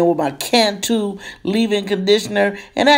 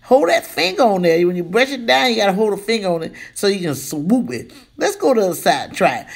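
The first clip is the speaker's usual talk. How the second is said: usual speaking voice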